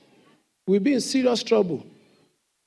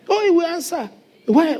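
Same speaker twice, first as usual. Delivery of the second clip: ordinary talk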